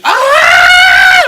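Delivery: very high, loud imitated shriek; imitated scream. Teller imitating the livid girl in the hallway, high scream. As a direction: mimic a scream at the top of the lungs. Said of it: "the bitch started screaming".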